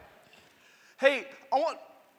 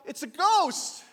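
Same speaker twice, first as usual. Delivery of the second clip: raised, louder cry of alarm; 6 semitones higher, 6 dB louder, slower than usual. A narrator's reading. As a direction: call it out higher, like startled disciples. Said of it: "They cried out".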